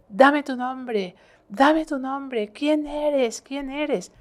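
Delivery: high, slow, insistent demand; exorcist's command. Retold or imitated. Priest demands the entity's name during exorcism, insistent commanding call. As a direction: call it out like a priest confronting a demon.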